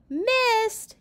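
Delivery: put-on high, loud gleeful-boy yell. A narrator's reading. Boys taunting their father in play, yelling. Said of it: "yelled the boys".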